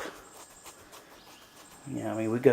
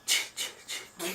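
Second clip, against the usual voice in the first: whispery, voiceless 'ch, ch, ch' imitation; imitated Friday the Thirteenth stalker noise; horror-movie sound effect; 7 semitones higher, 9 dB quieter than usual. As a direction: tease with a slasher-film sound. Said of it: "like a Friday Thirteenth".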